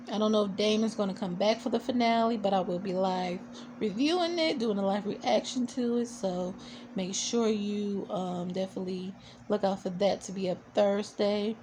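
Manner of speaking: normal talking voice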